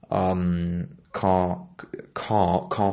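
ordinary talk

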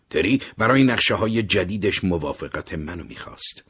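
usual speaking voice

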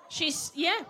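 ordinary delivery

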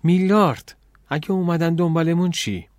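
high, exasperated challenge; teeth-grinding irritation. A narrator's reading. Girl challenges her invisible companion, irritated. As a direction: call the name sharply, then demand an answer. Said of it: "دندوناشو رو هم سایید".

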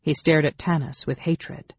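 ordinary delivery

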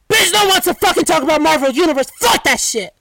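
inner scream. Retold or imitated. Vlogger performs the scream she held back about Marvel. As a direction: let the unsaid scream rip out. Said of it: "I didn't scream like that".

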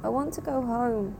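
put-on child's voice. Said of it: "you hear it say in a child's voice".

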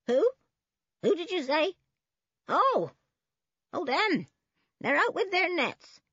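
put-on high-pitched querulous old woman; grumbling old woman. A narrator's reading. Old woman answering the policemen's question, high and querulous. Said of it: "mumbled the old woman".

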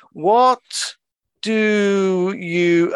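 loud, high, long-stretched words; slow sloth. Retold or imitated. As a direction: carry on as the sloth, oblivious and unhurried. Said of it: "the sloth turns to his cohort".

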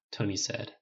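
ordinary delivery, nothing marked

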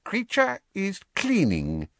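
slow, high elf voice; evasive. A narrator's reading. House elf answering George's question, evasive. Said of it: "he said evasively".

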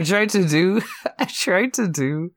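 high-pitched, laughing through the words; giggly, breaking off into laughter; laughing helplessly. Real, not acted. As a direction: struggle to explain while cracking up. Cracking up at own failed accent.

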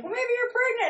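high mock mom voice; hammed-up nosy mom; mom's voice. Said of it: "the mom was like".

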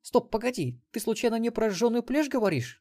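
put-on high girl's voice, suddenly alert; wary granddaughter. A narrator's reading. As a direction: cut in high and suspicious. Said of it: "насторожилась внучка". (насторожённая внучка, высоким голосом)

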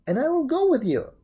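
high, loud monkey character. A narrator's reading. Monkey offering to join Momotaro, high loud voice. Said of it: "said the monkey".

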